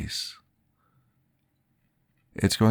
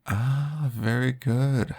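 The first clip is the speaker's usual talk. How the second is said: bright, higher burst of delight; light up at the happy memory; 7 semitones higher, slower than usual. Acted.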